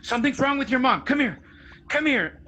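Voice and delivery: high, urgent fake panic; dad pranking his son, faking alarm about mom; mock alarmed dad. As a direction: pretend something terrible happened. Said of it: "and he's like".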